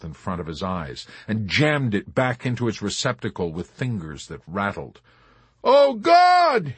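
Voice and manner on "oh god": very high, loud scream; shrieking in terror. A narrator's reading. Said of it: "he kept shrieking with no abatement of terror".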